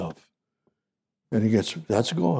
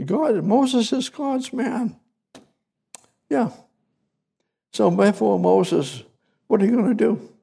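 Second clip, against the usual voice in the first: excited exclaim; 11 semitones higher than usual. Real, not acted. Preacher exclaims, pitch leaps.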